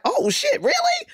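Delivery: high squealing mock surprise; mock shock. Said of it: "and he's like".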